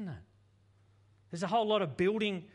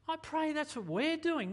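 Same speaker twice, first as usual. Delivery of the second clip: ordinary talk, nothing marked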